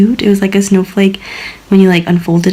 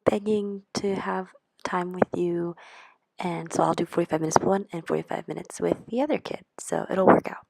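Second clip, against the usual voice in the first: whispered dad quote; 14 dB quieter than usual. Retold or imitated. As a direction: tell it like a secret aside.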